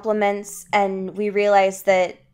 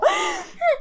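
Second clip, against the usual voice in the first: laughing sigh; 5 dB quieter than usual. Real, not acted. Exasperated after the plug joke.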